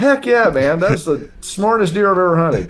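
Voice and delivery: high-pitched, excited retold reply; pumped. Hunter answering doubters about his prized deer, pumped. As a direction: re-enact being pumped, pitch jumping up. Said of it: "I'm so pumped".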